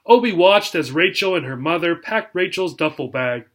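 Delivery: ordinary delivery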